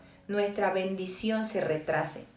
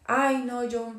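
whiny lazy excuses; 4 dB quieter than usual. Retold or imitated. Mocking lazy believer's excuses.

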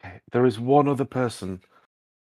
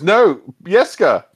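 higher, slower call across the room; 7 semitones higher, 3 dB louder than usual. Acted. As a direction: call the boy over, impatient. Priest calling the altar boy.

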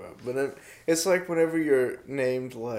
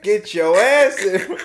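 slow, raised holler played for laughs; mock calling out; 5 semitones higher, 5 dB louder, slower than usual. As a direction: summon the mechanic in jest, louder and higher. Joke summons to the mechanic.

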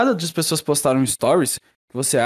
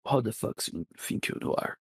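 whispered; 5 semitones lower, 13 dB quieter, slower than usual. Acted. Angry line whispered in English.

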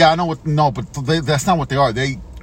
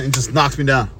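ordinary talk

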